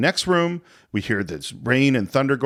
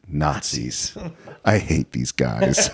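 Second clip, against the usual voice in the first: low, slow, weary growl of disgust; deep, slow Indy sneer at the Nazis; Indiana Jones impression; 11 semitones lower, slower than usual. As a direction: drop way down and drag out the contempt. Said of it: "And he says".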